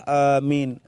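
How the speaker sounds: low, drawn-out mock amen; low-handed amen. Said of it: "Ada yang letak di bawah".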